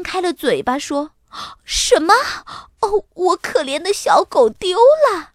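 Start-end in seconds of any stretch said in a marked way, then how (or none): 1.6-5.3 high, wailing woman's outcry; shocked dismay. A narrator's reading. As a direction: cry out in alarm over your poor dog. Owner shocked her dog is lost.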